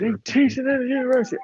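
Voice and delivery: high-pitched mock yell, little added volume; retold yell. Debater retelling an opponent's outburst, high mock yell. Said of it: "Then he yells out".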